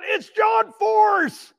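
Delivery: high, drawn-out excited call; retold kid's yell. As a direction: imitate a kid hollering a famous name, stretched out. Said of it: "a little kid about twelve, fourteen yelled".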